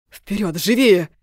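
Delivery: loud, slow urgent command, in a different voice; urgent shout. Acted. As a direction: drive her on, shouting. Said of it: "подтолкнул меня в спину Влад".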